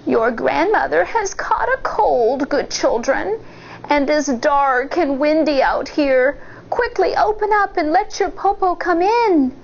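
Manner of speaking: wolf coaxing in fake granny voice; lightly put-on granny voice; cunning wolf. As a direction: sweet-talk them into opening. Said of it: "the cunning wolf said".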